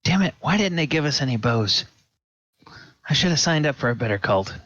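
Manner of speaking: annoyed henchman griping; grumbling cultist. Acted. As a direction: cultist griping about his cult, fast. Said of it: "he's like".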